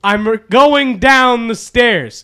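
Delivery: high, loud, slow formal announcing; pompous herald. Pretend. Friend mocks Jake announcing himself to grandma, pompous. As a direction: proclaim like a royal herald. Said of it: "announce your presence loudly".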